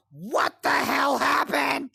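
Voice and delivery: loud, slow, shocked grandmother; alarmed shout. Retold or imitated. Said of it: "immediately goes".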